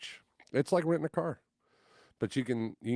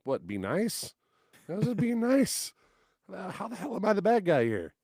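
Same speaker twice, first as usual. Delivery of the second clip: high, loud disbelieving protest; retold outrage at being arrested, high and loud; indignant outburst; 11 semitones higher, 5 dB louder than usual. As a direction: pitch it up in outrage at the cop.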